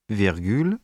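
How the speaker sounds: ordinary talk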